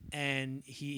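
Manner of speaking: ordinary talk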